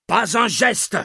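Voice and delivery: high insistent shouted command. A narrator's reading. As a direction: repeat the order, pushing harder. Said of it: "insista Bloch".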